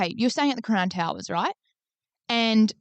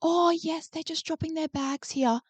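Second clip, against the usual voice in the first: imitating the cleaner; 7 semitones higher, 6 dB quieter, slower than usual. Cleaner casually explaining the intrusion.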